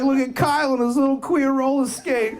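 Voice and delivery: high, mocking buddy voice; hammed-up taunting friend. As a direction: sneer it like a teasing friend. Friends mocking him, quoted.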